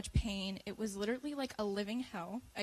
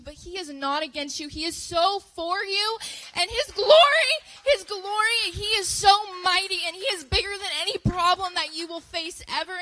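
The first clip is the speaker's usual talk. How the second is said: much higher and louder, building to a cry; fiery preaching; 4 semitones higher, 8 dB louder than usual. Real, not acted. Telling the youth group God is mighty, preaching at full force.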